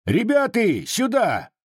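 high call for backup; urgent shout. A narrator's reading. Disarmed Cossack shouting to summon his mates. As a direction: yell for your comrades. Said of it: "крикнул".

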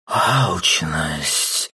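hissing snake shout; hissing serpent. Acted. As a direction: spit the word out like a serpent. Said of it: "прошипела змея".

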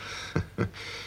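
a short, voiceless laugh before confessing; quiet bitter laugh. Acted. Arrested man laughs quietly before telling how his brother found out. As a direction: huff a laugh almost silently.

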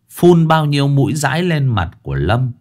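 plain reading voice

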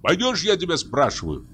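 high, insistent demand; angry shout. A narrator's reading. Hunter confronts the forester, angry cry. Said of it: "крикнул охотник, злобно тараща глаза".

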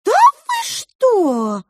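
very high, drawn-out amazement; aghast mouse, slow and piping; gasping mouse. A narrator's reading. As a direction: stretch it into a loud squeal of shock. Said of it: "снова ахнула розовая мышь".

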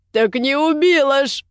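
usual speaking voice

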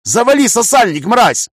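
loud, high angry bark. A narrator's reading. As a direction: bark it furiously. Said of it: "рявкнул я на неё".

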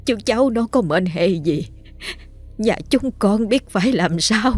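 slow, soft, trembling prayer to the spirits; tearful pleading. Acted. Grandmother begging graveyard spirits for her grandson, slow, soft and tearful. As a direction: beg on your knees, slow and hushed. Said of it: "mặt mày mếu máo lạy lục cầu xin".

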